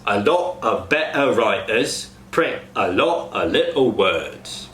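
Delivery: Cockney with T cut off in "better", "little"; Cockney glottal stops. Shown as a demonstration. Coach demos glottal stops to cast. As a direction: glottal stop example sentence. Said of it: "Example sentence".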